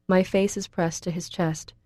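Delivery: plain reading voice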